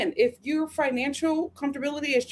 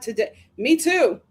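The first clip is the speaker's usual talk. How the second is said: normal talking voice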